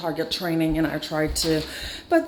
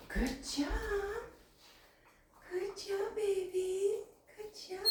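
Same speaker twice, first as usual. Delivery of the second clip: high, soft praise; talking to a pet; 12 semitones higher, 13 dB quieter than usual. Real, not acted. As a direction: coo it up high to the bird. Praising bird.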